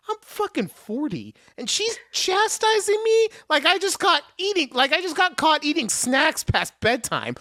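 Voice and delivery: high-pitched, incredulous comic whine; inner outrage. Acted. Man chastised like a kid with snacks, incredulous. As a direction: squeal it in disbelief. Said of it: "But in my head this whole time".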